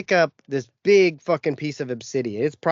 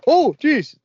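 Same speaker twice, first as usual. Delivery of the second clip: startled yelp; 13 semitones higher, 7 dB louder than usual. Retold or imitated. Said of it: "Startled me".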